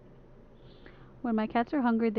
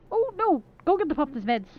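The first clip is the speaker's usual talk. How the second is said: alarmed; 5 semitones higher than usual. Real, not acted. Sudden alarm.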